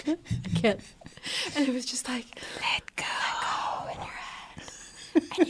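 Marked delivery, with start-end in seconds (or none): 2.6-5.2 imitating a whisper. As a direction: whisper it slowly like a teacher during a head assist. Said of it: "and she'd just whisper".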